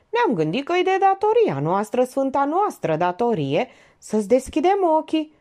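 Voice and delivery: high, impassioned declamation; pathetic thundering. A narrator's reading. Jack telling Dănuț of their sacred duty, pathetic thundering. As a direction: proclaim a sacred duty with overblown pathos. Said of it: "Tună patetic".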